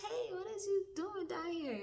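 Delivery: high, bright-eyed surprise at seeing him; surprised greeting. Retold or imitated. Retelling greeting the boy again, high surprised hello. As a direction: greet him like an old face you didn't expect. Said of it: "And I like".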